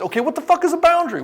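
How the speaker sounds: outraged by a useless answer; exasperated client. Retold or imitated. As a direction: client squeals at his counselor in disbelief.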